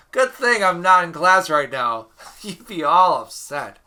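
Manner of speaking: loud, high-pitched amusement; amused, laughing. Real, not acted. Teacher joking to absent class about the mistake, laughing. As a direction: laugh off your own blunder loudly.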